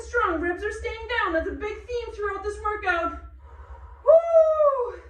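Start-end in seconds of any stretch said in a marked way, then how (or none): 4.0-5.0 loud high whoop of effort; excited whoop. Real, not acted. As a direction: let out a big cheering woo. Coach cheers mid-exercise.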